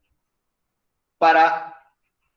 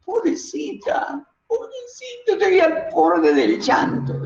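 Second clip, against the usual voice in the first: overdone quavering cry, pitch rising all through; exaggerated tearful trembling; 8 semitones higher than usual. Shown as a demonstration. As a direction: voice climbing much higher, breaking into near-sobs. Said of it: "estoy, estoy exagerándolo".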